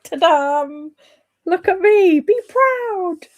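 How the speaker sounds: hammed-up boasting, like a kid showing off; mock proud show-off. Streamer playfully voices the chat's proud emoji, hammed-up and high.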